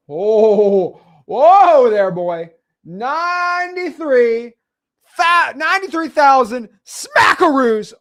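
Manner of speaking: whooping hyped-up shout, high and loud; real estate hype man yelling at the numbers; excited. Real, not acted. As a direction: cheer it like a jackpot win. Hyped reaction to big deal.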